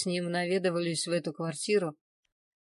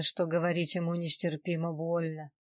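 plain reading voice

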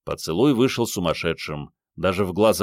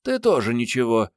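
higher female voice, condescending praise; magnanimous; 5 semitones higher than usual. A narrator's reading. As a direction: grant it generously, pitched up. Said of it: "великодушно признала Мариетта". (великодушно, повышенным женским голосом)

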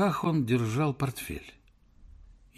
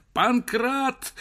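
hysterical yell; 12 semitones higher than usual. Acted. Professor hysterically screams for Pankrat. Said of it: "истерически закричал Персиков".

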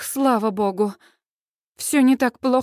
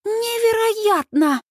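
high, slow rapture; drawn-out high amazement; delighted exclamation; 10 semitones higher, much slower than usual. A narrator's reading. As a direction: marvel at it, stretching the word out. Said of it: "в восторге выдыхает Сьюз".

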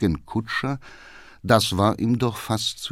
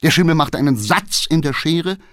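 usual speaking voice

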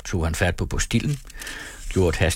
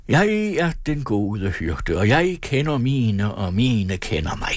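reciting by heart; 3 semitones higher, slower than usual. A narrator's reading. Carlson recites scripture by heart, in a chanting sing-song. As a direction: reel it off like a learned catechism, drawn out and chanted. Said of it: "remsede han op udenad".

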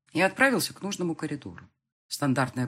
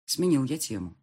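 usual speaking voice

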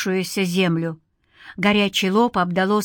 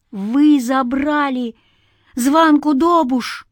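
high, slow, drawn-out accusation; rising accusation; 6 semitones higher, 3 dB louder, slower than usual. A narrator's reading. Man accusing spirits of taking his girl, rising to a cry. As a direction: build towards a cry, stretching each word. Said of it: "и голос сорвался на крик".

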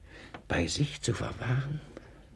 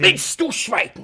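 much higher and far louder; furious shout; 12 semitones higher, 10 dB louder than usual. Acted. Master silencing his servant, furious shout. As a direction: roar him into silence.

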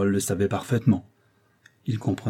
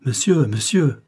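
higher, louder call after someone; 4 semitones higher, 6 dB louder, slower than usual. A narrator's reading. Painter calls after his departing visitor from the landing, calling out. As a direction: call down the stairwell in full voice. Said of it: "il appela à pleine voix".

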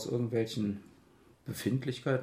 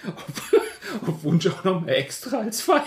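laughing; 9 semitones higher, 8 dB louder than usual. Real, not acted. Teasing while laughing.

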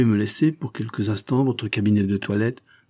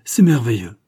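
louder, mildly amazed exclamation; a touch of delighted astonishment; admiring exclamation; 7 dB louder than usual. A narrator's reading. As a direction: exclaim in wonder, with a push of volume. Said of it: "s'écria-t-il".

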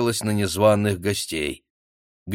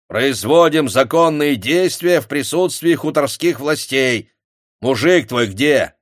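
stern official; 5 semitones higher, 5 dB louder than usual. A narrator's reading. Official questioning a peasant woman.

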